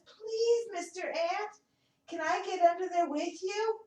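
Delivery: high, small begging voice; a little wet butterfly asking nicely; pleading butterfly. Acted. Butterfly begs the ant for shelter, high pleading voice. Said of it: "He comes over and says".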